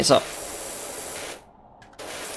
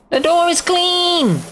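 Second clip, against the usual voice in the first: very high, drawn-out squeal; squeaky celebration; 12 semitones higher, slower than usual. Pretend. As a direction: repeat it in a silly high voice. Celebrates again, much higher.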